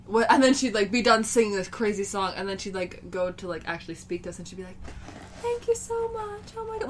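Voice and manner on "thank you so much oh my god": much higher, light and breathless thank-you; Florence's tiny voice. Retold or imitated. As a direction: mimic a star suddenly sounding sweet and small. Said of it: "was so high-pitched and light".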